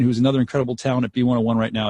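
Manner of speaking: ordinary talk, nothing marked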